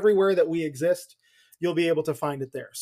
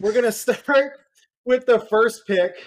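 dramatic; 5 semitones higher, 5 dB louder than usual. Pretend. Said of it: "I'm nothing if not dramatic".

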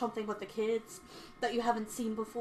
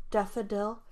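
usual speaking voice

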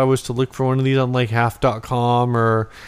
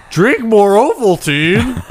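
high, loud, drawn-out ad slogan; big joking announcement, pitched way up; mock slogan; 9 semitones higher, 5 dB louder, slower than usual. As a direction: proclaim it slowly like a hammed-up secret message. Mock slogan after decoder rings joke.

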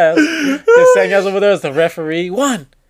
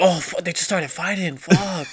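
much softer, hushed retold alarm; hushed mock alarm; 8 dB quieter than usual. Kids watching a fight begin, hushed mock alarm. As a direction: mutter it like they saw trouble start. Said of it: "we'd all be like".

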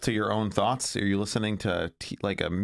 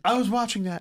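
high mock protest; hammed-up groggy boyfriend; imitating boyfriend; 9 semitones higher than usual. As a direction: squeak out the sleepy objection. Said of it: "He was".

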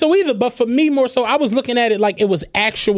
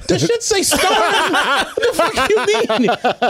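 laughing disbelief; 6 semitones higher, slower than usual. Retold or imitated. Retelling confusion at the credits.

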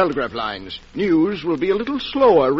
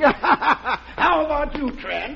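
chuckling through the question, drawn out; laughing; 10 semitones higher, much slower than usual. Acted. Host laughing as he turns to his guest Trent. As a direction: laugh the words out after a heavy meal.

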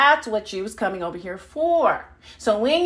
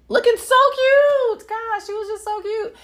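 gushing; 14 semitones higher, 4 dB louder than usual. Retold or imitated. Gushing over cute dude.

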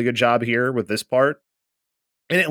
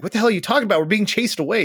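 higher, incredulous snap at Luffy; exasperated Nami; 9 semitones higher, much faster than usual. Acted. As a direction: throw up your hands at his nonsense. Nami scolding Luffy while fleeing.

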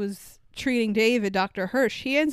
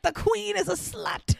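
mock scream; 11 semitones higher than usual. David yelling at a cop to get arrested, mock high yell. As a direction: shriek it like a mild mock yell. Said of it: "starts screaming random shit".

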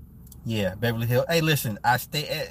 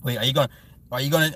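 ordinary delivery

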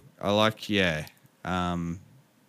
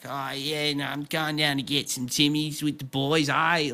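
broad ocker northern Australian, pitched higher; higher-pitched mock ocker from up north; 5 semitones higher than usual. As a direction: put on a broad ocker Aussie accent. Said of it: "sound a little bit more, um, ochre".

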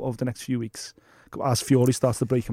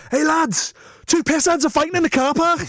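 high, urgent call into the gym; excited news; 14 semitones higher, 3 dB louder than usual. Retold or imitated. Gym mate bursting in to announce a car park fight, excited. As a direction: burst in breathless with gossip. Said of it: "someone runs in the gym and goes".

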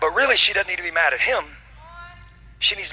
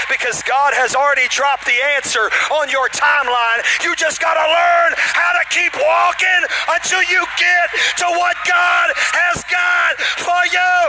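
shouted, much higher, slow and stretched; full-force preaching; 10 semitones higher, 6 dB louder, slower than usual. Acted. Preacher shouts the climax of his sermon to the church. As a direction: build to a roaring climax, slow.

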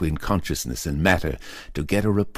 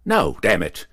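louder, sudden flash of temper; angry snap; 3 semitones higher, 6 dB louder than usual. Acted. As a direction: snap it out in a blaze of anger. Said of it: "Carson snapped in a sudden blaze of anger".

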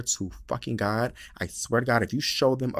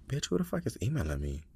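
ordinary talk

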